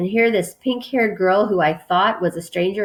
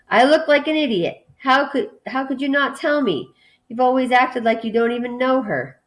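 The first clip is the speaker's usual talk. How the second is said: higher-pitched, exasperated reproach; annoyed protest; 6 semitones higher than usual. A narrator's reading. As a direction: read it as an indignant, wounded complaint. Annoyed boy argues with his friend.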